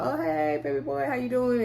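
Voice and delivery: high, sugary flirt voice; flirty greeting. Retold or imitated. Woman sweetly greets a guy at the spades game, high flirty voice. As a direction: put on a coy, sweet hello.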